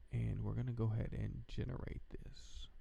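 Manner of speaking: whispered aside. Real, not acted. About to click generator.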